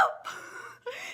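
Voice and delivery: airy high gasping laugh with no voice; a stunned, whispered giggle of disbelief; breathless gasp-laugh. Acted. Bill gasps with laughter at his own arms.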